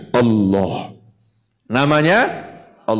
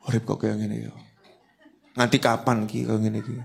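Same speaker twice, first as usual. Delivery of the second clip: sulky mutter; 7 semitones lower, 8 dB quieter, faster than usual. Retold or imitated. Imitated sulky man grumbling.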